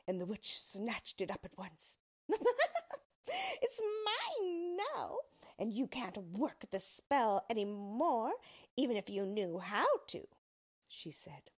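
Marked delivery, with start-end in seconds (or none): 2.3-3.0 short high cackle; high gloating witch laugh; witch's cackle. A narrator's reading. Witch gloats after snatching shoe. 3.3-10.3 slow, drawn-out witch voice starting very high; high drawn-out crow settling into a taunt; gloating witch. A narrator's reading. Witch gloats over stolen shoe.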